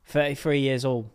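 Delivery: thirty-three said as fetty-free; British f for th, fetty-free; British accent. Shown as a demonstration. Husband showing the British way, fetty-free. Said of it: "they don't say thirty-three".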